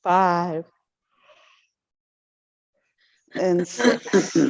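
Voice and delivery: words stretched by a yawn. Real, not acted. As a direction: yawn through the count. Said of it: "Don't mind me, I'm yawning".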